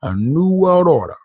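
mock presidential address, slow and deliberate; President Bush Senior. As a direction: draw each phrase out like a solemn speech.

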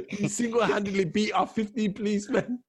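laughing read. Real, not acted. Reading a comment, laughing.

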